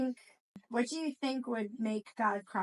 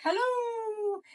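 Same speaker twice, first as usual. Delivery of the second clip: echo calling back; 11 semitones higher than usual. Acted. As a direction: call it back like a distant echo. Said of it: "a voice call back".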